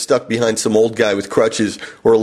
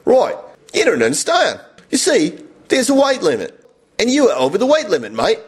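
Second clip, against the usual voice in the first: high-pitched, exaggerated broad Aussie; Australian Cockney accent; 7 semitones higher than usual. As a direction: do your best thick working-class Australian accent. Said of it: "I'll just do the best I can".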